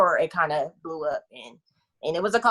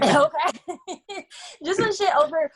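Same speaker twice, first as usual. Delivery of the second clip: laughing; 11 semitones higher, slower than usual. Real, not acted. Laughing through tears.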